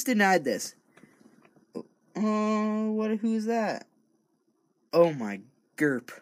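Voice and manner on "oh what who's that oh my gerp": surprised. Real, not acted. Surprised by someone in studio.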